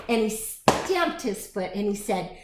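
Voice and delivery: plain reading voice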